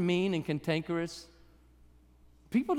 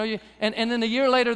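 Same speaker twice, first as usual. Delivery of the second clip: ordinary talk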